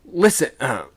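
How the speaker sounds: loud, high command; urgent order. A narrator's reading. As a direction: order everyone to listen. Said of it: "ordered the second mate".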